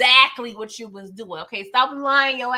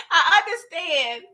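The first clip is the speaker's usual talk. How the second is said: laughing, drawn out; 4 semitones higher than usual. Shown as a demonstration. Teasing Diana on camera, drawing out "I understand" while laughing. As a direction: stretch out "I understand" slowly through a laugh. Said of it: "And I understand".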